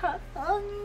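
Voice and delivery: tiny, high, soft question; imitated tearful squeak. Woman mimics friend wiping away tears, high tearful squeak. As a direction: let it squeak out through mock tears. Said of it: "trying to rub them away".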